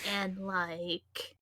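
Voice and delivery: put-on Valley Girl accent, endings drawn up like questions; Valley Girl uptalk accent. Shown as a demonstration. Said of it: "it sounds like there's a question mark".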